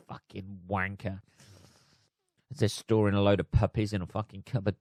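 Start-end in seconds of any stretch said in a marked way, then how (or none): 0.1-1.2 low, very quiet swearing aside; muttered curse. Retold or imitated. Pet owners cursing the leader behind his back, low muttered insult. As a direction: curse him under your breath. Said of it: "Of course they're going".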